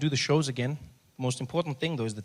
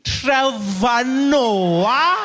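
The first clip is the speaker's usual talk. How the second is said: very drawn-out, high, loud question; hammed-up surly receptionist; suspicious check-in woman; 10 semitones higher, 11 dB louder, much slower than usual. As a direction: stretch every syllable in accusing recognition. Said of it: "She's not friendly at all".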